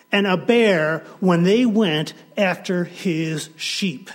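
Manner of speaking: loud, driving sermon voice; full-force preaching. Real, not acted. The preacher to his congregation, loud and fervent. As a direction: preach it to the back row.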